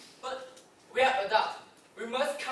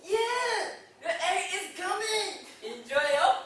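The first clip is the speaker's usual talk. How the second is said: put-on Filipino accent; 8 semitones higher, 4 dB louder than usual. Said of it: "It's a Filipino accent".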